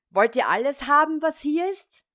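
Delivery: high, loud and fast yell; shouting with all his might. A narrator's reading. Tiny thief yells from the parson's room, full-throated shout. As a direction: bellow it through the whole house. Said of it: "schrie aber gleich aus Leibeskräften".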